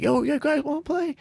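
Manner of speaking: put-on very high-pitched kid voice; squeaky mock-teen invite; eager kid imitation. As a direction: mimic a kid asking to join, way up high. Imitating kid inviting them to play.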